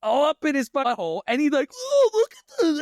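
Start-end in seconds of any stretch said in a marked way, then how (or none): 1.7-2.8 high, louder excited cashier. Retold or imitated. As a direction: shriek with delight at the phone. Cashier voiced in story.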